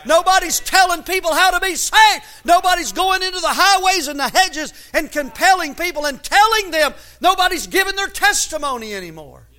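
loud, high-pitched pulpit cry; impassioned preaching. Real, not acted. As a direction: drive each phrase home to the congregation. Preacher rises to full force.

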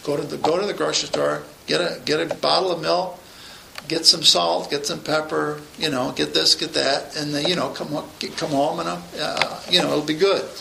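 low, soft offhand mumble; unimportant grocery-list talk. Shown as a demonstration. Preacher showing how he would read a grocery list, quiet and offhand. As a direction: rattle it off like a trivial shopping errand. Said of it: "maybe I'd talk like this".

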